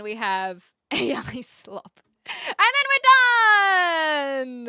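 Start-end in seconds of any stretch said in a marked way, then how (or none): 2.3-4.7 loud, high and drawn out very slowly; excited. Real, not acted. Streamer cheering that the clip list is done, drawn-out shout. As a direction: stretch the finish out like a victory cry.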